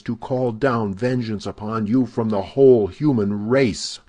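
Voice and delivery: furious vow. A narrator's reading. Milady swearing vengeance at her captor, furious and loud. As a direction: swear it at him with rising fury.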